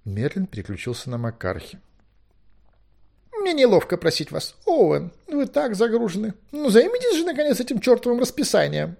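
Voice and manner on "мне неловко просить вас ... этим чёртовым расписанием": high, loud, fussy figure; high-pitched awkward plea turning impatient; flustered Merlin. A narrator's reading. Merlin asks Owen to fix the timetable, flustered.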